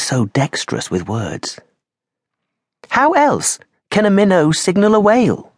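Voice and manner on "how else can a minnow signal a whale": much higher, lighter woman's voice; Lola's voice. Acted. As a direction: voice her as a playful aristocrat. Lola's voice remembered.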